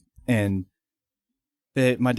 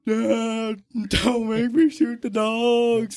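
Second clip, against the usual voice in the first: high, slow, hammed-up crying kid begging; mock sobbing plea; 11 semitones higher, much slower than usual. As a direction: beg Dad like a bawling child, much higher and slow. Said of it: "I am bawling, sobbing".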